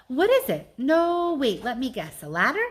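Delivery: high, loud eager guessing; Bob's eager guess. Acted. Bob guessing what Ivan painted, high and loud. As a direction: guess it excitedly. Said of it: "Bob guessing".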